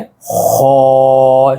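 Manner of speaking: खौ opened on a rasped kh, vowel held long; rounded mouth. Shown as a demonstration. Teacher repeats the letter for learners, खौ rounded and held long. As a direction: sustain खौ through rounded lips on one note. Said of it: "शुरू की जो आवाज़ हम निकाल रहे".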